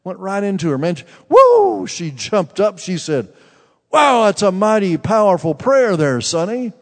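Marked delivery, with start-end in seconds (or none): 1.3-1.8 high, loud joyful whoop; joyful high shout; excited whoop. Real, not acted. Excited exclamation at the healing. 3.9-6.7 put-on louder, higher amazed old-lady voice; the aunt marvelling at the prayer; delighted old lady exclaiming.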